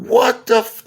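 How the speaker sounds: high, loud startled outburst; panicked yelp. Retold or imitated. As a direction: blurt it in alarm. Panicking as doctor rushes him.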